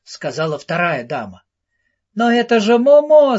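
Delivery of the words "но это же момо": a louder, higher cry with a laugh behind it; with a touch of laughter in the cry; laughing shout. A narrator's reading. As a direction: call out gladly, half laughing. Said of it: "смеясь, крикнул Джиги".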